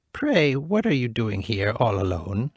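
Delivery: put-on slow old woman voice. Old woman asking the lone princess, slow. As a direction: ask slowly and kindly. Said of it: "she said".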